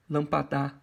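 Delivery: "lampadá" with the last syllable stressed, lam-pa-DÁ. Shown as a demonstration. Teacher rejecting a wrong version, final stress on "lampadá". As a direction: land loudly on the end of "lampadá". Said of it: "não".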